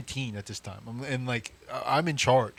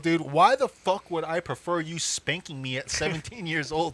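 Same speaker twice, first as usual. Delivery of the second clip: raised, higher voice arguing back; indignant rant; 4 semitones higher, 4 dB louder than usual. Retold or imitated. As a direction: argue it point by point in comic outrage. Indignant argument with dad.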